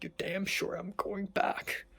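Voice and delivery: breathy hushed whisper, slowed down. Retold or imitated. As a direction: say it under your breath, slowly. Quoted resolve, hushed.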